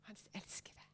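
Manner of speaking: soft whispered words. Real, not acted. Preacher saying she is still God's beloved, whispered. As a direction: let it fall to a whisper. Sermon climax on being loved.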